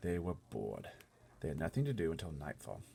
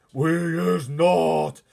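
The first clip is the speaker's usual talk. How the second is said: slow, huge, much louder chorus shout; giants shouting; 11 semitones higher, 19 dB louder, much slower than usual. Acted. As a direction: bellow it together like a mob. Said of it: "shouted the other giants".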